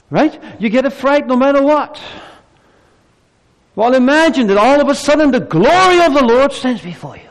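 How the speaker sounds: high and loud, full force; preacher crying out about the glory before you; fervent exclamation. Acted. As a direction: hit them with the awe of it. Preacher exclaims about meeting God.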